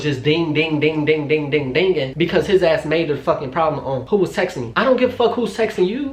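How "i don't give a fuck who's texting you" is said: high-pitched, exasperated outburst; indignant dismissal. Retold or imitated. Woman ranting at boyfriend about his texting suspicions, indignant. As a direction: toss it out, utterly fed up. Rant aimed at absent boyfriend.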